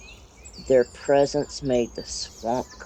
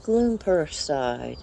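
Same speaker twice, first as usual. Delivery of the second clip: usual speaking voice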